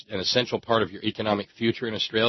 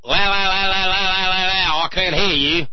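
loud, high singsong la la la; childish yelling to drown out others; mock yell; 10 semitones higher, 5 dB louder than usual. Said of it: "stick his fingers in his ears and yell".

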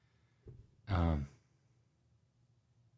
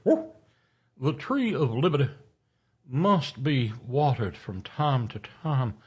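orating quote; 5 semitones higher than usual. Shown as a demonstration. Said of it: "wait, he had a thin, high, reedy voice".

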